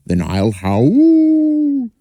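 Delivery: very high, very slow howl-like dog voice. Acted. Dog deciding to howl over Louse, slow and high. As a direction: stretch it out like a small dog about to howl.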